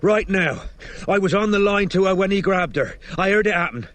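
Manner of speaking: urgent yelling. A narrator's reading. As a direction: yell urgently into the phone for help. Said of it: "Strike yelled into the boy's phone".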